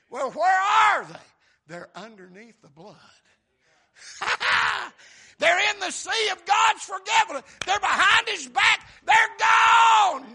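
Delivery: loud, high shouted preaching; preaching at full force. Acted. Preacher proclaiming sins gone to the church, shouting. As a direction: shout it out to the congregation.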